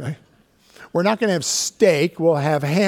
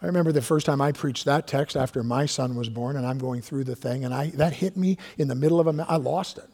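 usual speaking voice

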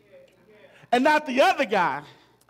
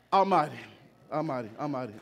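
each Almighty softer and lower; fading echo; 10 semitones lower, 5 dB quieter, faster than usual. Acted. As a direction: let Almighty die away. Repeated fading word.

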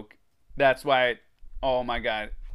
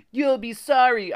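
high, loud taunting call; 11 semitones higher, 7 dB louder than usual. A narrator's reading. Alex calls after the fleeing kids, calling out. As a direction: call it after someone riding away. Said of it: "I heard Alex call after us".

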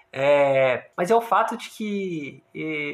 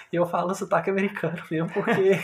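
usual speaking voice

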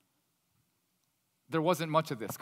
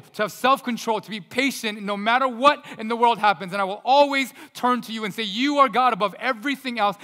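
rising, louder and higher with every line of the prayer; impassioned prayer; 4 semitones higher, 7 dB louder than usual. Acted. Preacher voicing a worshipper's prayer, building to loud and high. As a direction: pray out with growing fervor, near a shout at the peak. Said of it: "and you say".

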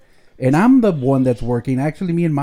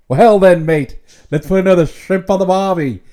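loud, hammed-up Aussie accent; mock Australian; 7 dB louder than usual. Host acting the Dumb and Dumber scene, loud hammed-up Australian. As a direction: belt the Aussie punchline. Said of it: "he goes".

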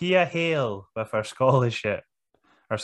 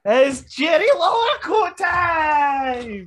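much higher, loud, slow drawn-out call; announcer shout; 5 dB louder, much slower than usual. Pretend. Host hyping the picture-in-picture break as a mock announcer, loud and drawn out. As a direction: belt it like a ring announcer.

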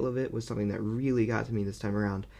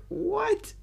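much higher incredulous yelp; baffled what. Retold or imitated. Reviewer recalling his own stunned reaction, incredulous. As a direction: squeak it in disbelief. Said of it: "And then I was like".

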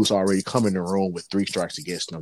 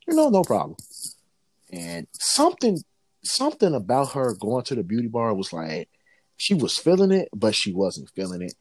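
normal talking voice

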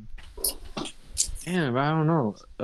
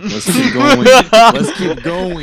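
laughing, higher and louder; 7 semitones higher, 10 dB louder, much slower than usual. Real, not acted. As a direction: push past it while laughing. Dodging the coronavirus question.

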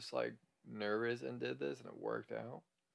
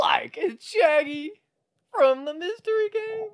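Shaggy; 14 dB louder than usual. Retold or imitated. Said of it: "It's so spot on".

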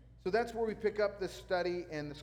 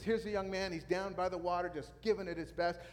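ordinary talk

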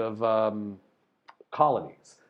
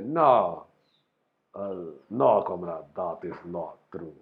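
bad Russian accent; 7 semitones lower than usual. Playing a Russian comrade denying it, clumsy Russian accent. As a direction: have another go at a hammy Russian accent. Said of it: "I don't think that's a Russian accent".

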